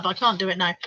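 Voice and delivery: ordinary talk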